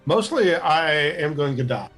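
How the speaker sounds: ordinary talk, nothing marked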